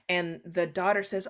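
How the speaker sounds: usual speaking voice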